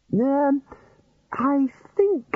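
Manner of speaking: high, hesitant start; hesitant wizard. Acted. Ridcully hesitates.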